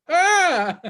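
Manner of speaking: high, loud "aah"; hammed-up crazed yell; mock attack scream. Mimics sanitizer attack.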